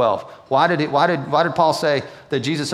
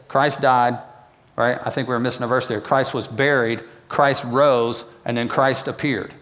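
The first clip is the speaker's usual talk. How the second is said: ordinary talk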